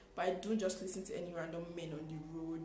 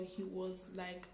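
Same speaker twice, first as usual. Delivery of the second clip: ordinary talk, nothing marked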